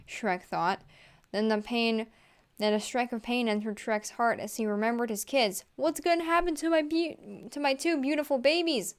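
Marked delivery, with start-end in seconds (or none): 5.8-8.9 high, pleading demand for his babies; distressed demand. Acted. Shrek begs the officer about his kids, high panicked demand. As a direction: push the pitch up in panic. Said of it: "Shrek demanded".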